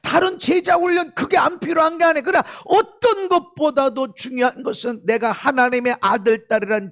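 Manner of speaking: loud, high-pitched preaching; forceful preaching. Real, not acted.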